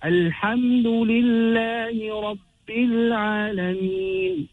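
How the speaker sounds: Alhamdulillahi Rabbil Alameen slow, long vowels, no break; joined recitation. Shown as a demonstration. Teacher reciting the whole verse, Alhamdulillahi Rabbil Alameen joined and stretched. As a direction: chant Alhamdulillahi Rabbil Alameen slowly in one flow. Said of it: "Hamzatul Wasl falls away".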